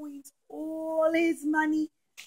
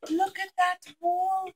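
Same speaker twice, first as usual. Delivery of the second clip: amazed; 6 semitones higher, 12 dB louder, much slower than usual. Acted. People see the clean wall.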